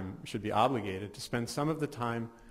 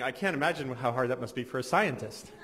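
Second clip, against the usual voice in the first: ordinary talk, nothing marked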